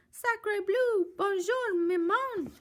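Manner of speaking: put-on high squeaky baby voice; baby duck. Baby duck greets Jack, high squeaky voice. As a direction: chirp it like a tiny duckling.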